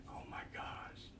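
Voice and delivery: breathless whisper of amazement; awed whisper. Retold or imitated. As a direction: barely voice it, stunned. Awed by the change.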